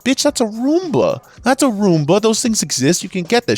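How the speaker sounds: high-pitched exasperated rant. Retold or imitated. Clerk mocking customer's idea of a vacuum, exasperated. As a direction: squeal it like it's blindingly obvious.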